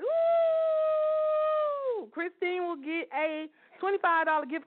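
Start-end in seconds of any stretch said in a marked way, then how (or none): 0.0-2.1 high, joyful whoop; excited whoop. Real, not acted. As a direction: cheer out loud. Cheering giveaway winner.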